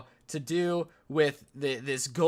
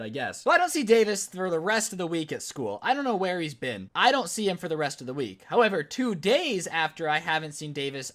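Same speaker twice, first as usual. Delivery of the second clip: ordinary delivery, nothing marked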